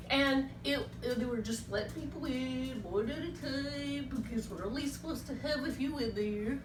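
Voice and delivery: a timid puppet sadly confiding his day; Ollie puppet voice. Acted.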